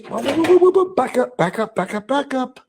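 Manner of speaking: high, rapid-fire mock halt; mock alarm. Halting Barry after his girlfriend statement, high mock alarm. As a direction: pile up the words high, as if stopping someone short.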